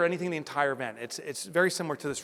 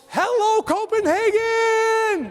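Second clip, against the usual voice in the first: high, loud, slow stadium shout; rock star greeting; 8 dB louder, much slower than usual. Retold or imitated. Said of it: "I'm like".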